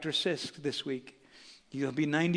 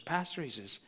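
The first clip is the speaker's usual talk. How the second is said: quiet, lightly voiced old churchgoer; a hint of an old man, soft; 10 dB quieter than usual. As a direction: gently voice an elderly man. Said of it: "he just looks at me and he goes".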